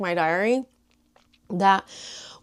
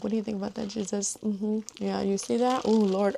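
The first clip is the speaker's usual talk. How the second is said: chatting to Jesus; 5 dB quieter than usual. Retold or imitated. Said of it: "when I'm in the car and I'm like".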